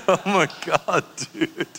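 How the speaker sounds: laughing through the words; can't hold the laugh in; laughing disbelief. Retold or imitated. Friends cracking up after Bert drives off, laughing.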